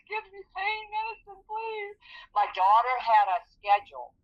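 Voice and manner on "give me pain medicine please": much higher, softer pleading; begging. Retold or imitated. Patient in pain begs for medicine, softly pleading. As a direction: whine for the medicine.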